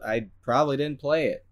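ordinary talk

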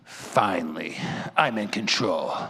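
low, gloating tyrant parent voice; sinister mock parent, slow and drawn out; controlling parent. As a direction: relish the power like a petty villain. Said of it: "the parent's gonna be like this".

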